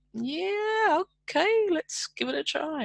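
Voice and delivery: high, lightly voiced willing agreement; recalling saying yes to the agency; hesitant yes. Retold accepting palliative care offer.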